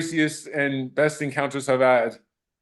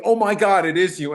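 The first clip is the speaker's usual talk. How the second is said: high, loud, thrilled gasp of recognition; excited fan; 5 semitones higher, 4 dB louder than usual. Retold or imitated. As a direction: burst out like a starstruck fan.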